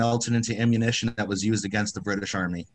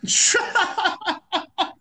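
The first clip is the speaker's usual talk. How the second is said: high laugh. Real, not acted. Joke about witches.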